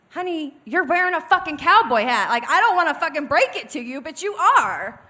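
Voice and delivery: loud, high retold tirade in another voice; snapping tirade. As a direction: snap like a TV drama fight, loud and high. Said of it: "She snapped like it was fucking Lifetime".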